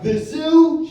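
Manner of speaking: loud, high mock-baffled query; incredulous high holler; needling mishearing. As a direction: shout it back as if you misheard. Waiter mishears her mockingly.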